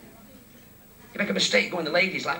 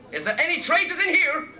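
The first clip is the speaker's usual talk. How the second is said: big Irish lad; 10 semitones higher than usual. Retold or imitated.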